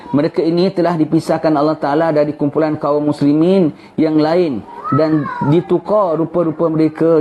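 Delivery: ordinary delivery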